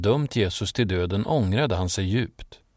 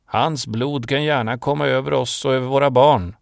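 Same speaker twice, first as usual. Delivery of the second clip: loud, raised-pitch crowd cry, building to the end; crowd shouting back; 5 semitones higher, 6 dB louder than usual. A narrator's reading. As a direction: shout back defiantly as one mob. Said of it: "folkmassan skrek tillbaka". (folkmassan skriker tillbaka högljutt)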